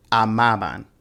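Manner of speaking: amaban as a-MA-ban; stress on second syllable. Shown as a demonstration. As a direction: lean on MA in amaban. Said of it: "you put the stress on the second one".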